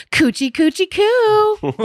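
put-on high cooing baby talk. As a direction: coo at a little child. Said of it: "Squeeze my cheeks and say".

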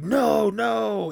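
high, insistent no no; stubborn refusal. Retold or imitated. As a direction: refuse to quit, pitched high. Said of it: "But Apollo's like".

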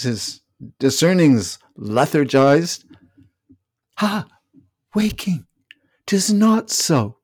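a bewildered king, rising to anguish; hammy stage actor. Acted. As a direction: rage and break, lost in yourself. Lear rages, lost.

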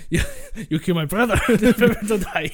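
hammed-up Spanish avenger through laughter; Spanish-accented vow, breaking into laughs; Inigo Montoya. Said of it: "Spanish orc was gonna be called Inigo Montoya".

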